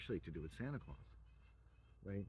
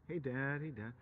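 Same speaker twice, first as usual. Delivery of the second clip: put-on child's greeting; 7 semitones higher, 5 dB louder than usual. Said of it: "kids greeting Santa again".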